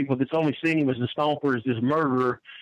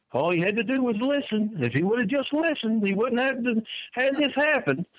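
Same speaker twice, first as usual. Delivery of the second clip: higher, light imitation of a mild polite Canadian; 8 semitones higher than usual. The Stomper calmly explaining after choking a guy, polite Canadian imitation. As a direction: mimic the Stomper sounding gentle and courteous. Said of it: "in that polite Canadian voice".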